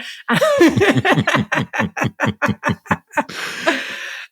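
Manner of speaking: long, high burst of laughter; helpless, high peals of laughing; real laugh. Real, not acted. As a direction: crack up at your own rant. Laughs at own retold rant.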